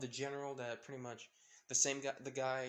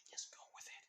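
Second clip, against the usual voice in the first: whisper; 12 dB quieter, faster than usual. Retold or imitated. Mulan aside to Izuku.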